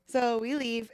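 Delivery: usual speaking voice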